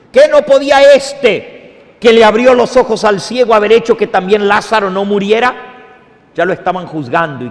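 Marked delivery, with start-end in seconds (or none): 0.1-5.5 louder, higher scornful retort; mocking critics' question. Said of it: "Eso fue exactamente lo que dijeron".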